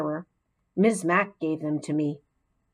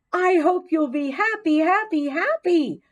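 very high, bouncing squeaky repeats; over-the-moon hamster cheer; delighted squeak; 10 semitones higher, 3 dB louder than usual. A narrator's reading. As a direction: squeal the happies with joy. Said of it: "I squeaked with delight".

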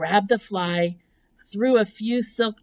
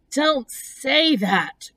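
slow, higher-pitched groan; groaning plea; 6 semitones higher, much slower than usual. Acted. Pig begs the spider to stop, slow high groan. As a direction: groan it out in drawn-out dismay. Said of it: "groaned Wilbur".

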